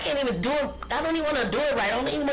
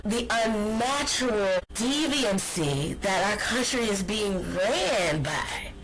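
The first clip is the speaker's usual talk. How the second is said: usual speaking voice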